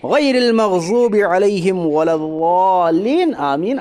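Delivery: slow, chanted tilawat of the verse; slow chanted Quran recitation. Shown as a demonstration. Teacher recites Quran verse aloud.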